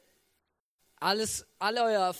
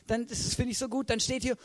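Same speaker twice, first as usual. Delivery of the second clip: ordinary delivery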